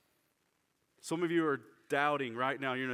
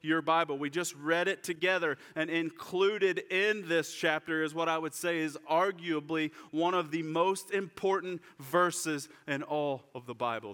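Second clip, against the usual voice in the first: ordinary talk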